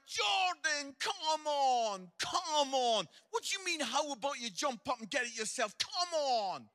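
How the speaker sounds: exasperated dad, half joking; mock exasperated. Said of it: "I'm like".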